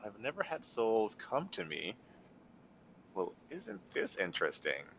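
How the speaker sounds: soft, sinister hag voice; a quiet, menacing purr; night hag. Acted. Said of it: "the night hag says".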